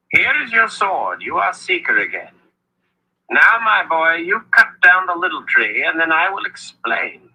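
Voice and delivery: put-on high, playful old wizard. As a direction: tease him like a sly old wizard. Wizard Zed speaks.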